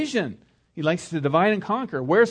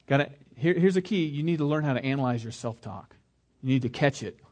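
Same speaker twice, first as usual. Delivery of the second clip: ordinary talk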